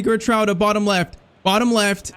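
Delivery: ordinary talk, nothing marked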